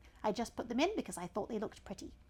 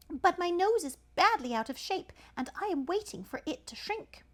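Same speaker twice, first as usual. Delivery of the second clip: much higher polite little voice; high child voice; 9 semitones higher, 5 dB louder than usual. Acted. Elephant's child answering the python in a high voice. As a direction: squeak it politely.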